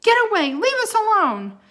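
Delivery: high, loud protective yell; angry shout. Acted. Jack yelling at the wolf sniffing Annie, angry. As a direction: defend her fiercely. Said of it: "He glared angrily".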